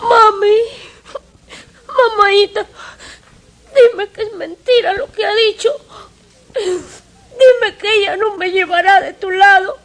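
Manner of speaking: cling to mami through sobs; sobbing child. Acted. Willy sobbing to his adoptive mother not to lose her.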